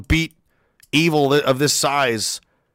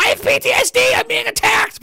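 very high, whiny mock complaint. Mocking city residents upset by honking, high whiny voice. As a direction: squeak it out like a crybaby. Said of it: "screaming about".

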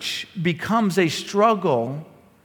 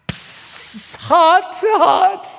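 put-on defiant child; 11 semitones higher, much slower than usual. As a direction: taunt like a toddler.

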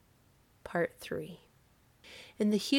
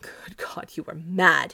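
breathy start bursting into a loud MAD; aghast; 6 dB louder than usual. A narrator's reading. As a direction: gasp it in horror, then burst out. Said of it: "he cried aghast".

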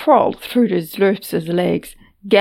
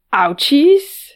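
higher and louder, disbelieving; incredulous echo; 7 semitones higher, 7 dB louder than usual. Acted. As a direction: echo the word in cringing disbelief. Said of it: "she cringed at herself".

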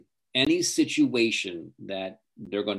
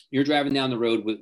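normal talking voice

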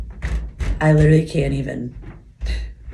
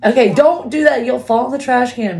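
raised, urgent parental warning; exasperated warning; 6 semitones higher, 5 dB louder than usual. Real, not acted. Mother warning child off the trash can, exasperated.

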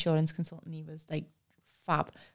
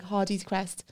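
ordinary delivery

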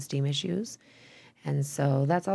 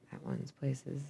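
half-whispered as you search; whispered aside; 10 dB quieter, slower than usual. Real, not acted. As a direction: whisper it to yourself. Muttering while searching the list.

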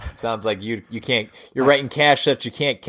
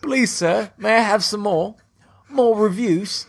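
high-pitched Dickensian British waif; put-on British orphan voice; mock British; 6 semitones higher than usual. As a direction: plead like a British urchin, pitched well up. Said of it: "all the lines I've got that I know that are British".

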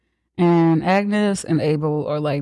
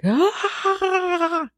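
mock scream, pitched way up; imitated scream; 13 semitones higher, 3 dB quieter than usual. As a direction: let out a hammed-up cathartic yell. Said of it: "[name] is screaming".

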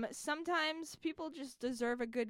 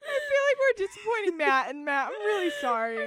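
fast, high and louder, laughing through the apology; 6 semitones higher, 7 dB louder, faster than usual. Real, not acted. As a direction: say sorry to Matt while cracking up.

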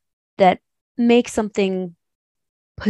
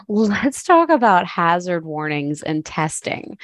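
usual speaking voice

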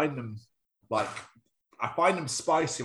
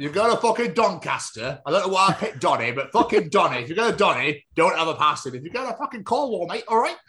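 loud, ranting mock Cornish accent; worked-up comic Cornish local; Cornish impression; 5 semitones higher, 5 dB louder than usual. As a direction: climb higher as the Cornish rant builds. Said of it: "I just wanted the Cornish impression back".

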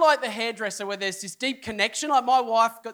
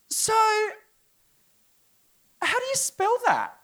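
puzzled barber; 12 semitones higher than usual. Retold or imitated. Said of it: "And then she goes".

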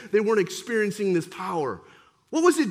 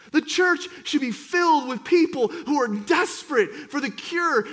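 fiery preaching; 3 semitones higher than usual. Acted. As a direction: preach it out with growing force. Preacher building to full force.

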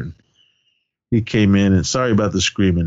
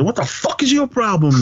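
mock confrontation; 10 semitones higher than usual. Uncle challenging the plate-grabber, mock indignation. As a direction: act out an indignant challenge lightly. Retold cleaver confrontation at party.

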